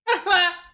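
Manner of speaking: high loud laugh; sudden high burst of laughter at the rant. Real, not acted. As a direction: crack up at the rant.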